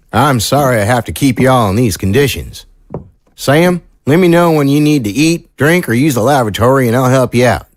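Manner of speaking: folksy small-town sheriff, apologetic. Acted. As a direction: play an obliging sheriff laying out house rules. Sheriff figure in audio drama.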